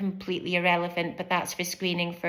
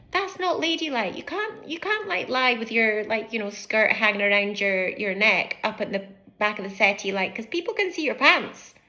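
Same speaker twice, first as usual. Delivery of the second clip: scolding adult; 2 semitones higher than usual. Retold or imitated. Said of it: "they get told".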